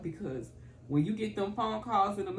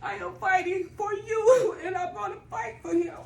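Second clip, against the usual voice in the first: tearful vow; 12 semitones higher, 6 dB louder than usual. Real, not acted. Caregiver vowing to fight for her father, tearful and loud. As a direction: force out the promise through tears. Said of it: "I just melt down".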